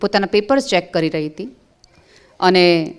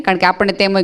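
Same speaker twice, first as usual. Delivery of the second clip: normal talking voice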